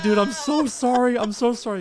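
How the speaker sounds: high, loud apology through laughter; laughing apology. Retold or imitated. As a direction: gush sorry while cracking up. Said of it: "I was like".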